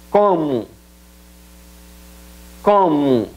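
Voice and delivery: como ending in a u sound, komu; Portuguese como. Shown as a demonstration. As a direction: model como with a final u. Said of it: "pronombre interrogativo como". (como pronunciado komu)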